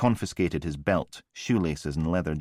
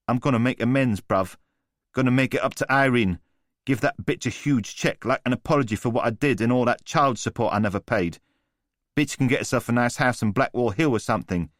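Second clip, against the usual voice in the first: higher, taunting chav with a sneer; cocky street thug; 6 semitones higher, 4 dB louder than usual. A narrator's reading. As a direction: needle him like a smug geezer. Voice of Newman the thug.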